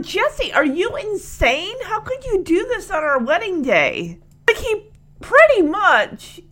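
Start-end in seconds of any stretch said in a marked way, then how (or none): 0.0-4.2 a touch higher, mock exasperated scolding; lightly voiced bride unloading on the groom; unloading on him. The bride scolding the groom on their wedding day, mock exasperated. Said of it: "Becky unloads on him".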